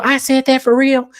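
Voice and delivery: high, mock-innocent question; hammed-up innocent Kay; innocent disbelief. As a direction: feign surprise way up high. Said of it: "She go".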